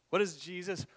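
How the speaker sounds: ordinary delivery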